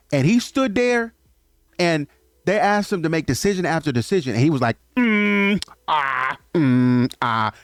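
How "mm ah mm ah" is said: drawn-out mock dithering noises; indecisive humming. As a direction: stall with long, level mms and ahs. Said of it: "Couldn't make a decision".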